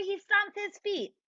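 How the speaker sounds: usual speaking voice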